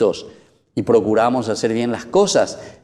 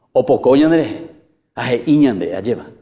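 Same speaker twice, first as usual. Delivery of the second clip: usual speaking voice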